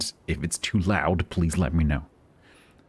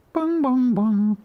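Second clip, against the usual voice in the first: high sung bong chime; sung doorbell chime; 5 dB louder, much slower than usual. Retold or imitated. Doorbell chime imitation again.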